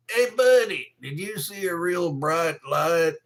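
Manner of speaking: put-on loud holler; mock calling out. Calls out in mock address.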